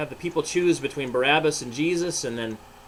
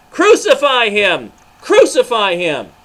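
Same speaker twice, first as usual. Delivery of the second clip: very loud, higher, slow chant; screaming crowd; 8 semitones higher, 13 dB louder than usual. Acted. Rioting crowd screaming at Pilate, loud slow chant. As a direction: scream it over and over as a mob.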